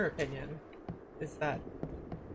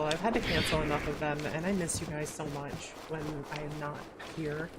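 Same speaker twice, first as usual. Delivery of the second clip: ordinary delivery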